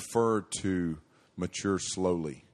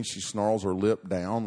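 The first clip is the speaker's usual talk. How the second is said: usual speaking voice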